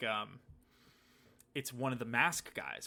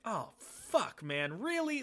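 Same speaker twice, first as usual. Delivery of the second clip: exasperated disbelief; 8 semitones higher, much slower than usual. Retold or imitated. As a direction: whine it like fate is mocking you. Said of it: "something really ridiculous".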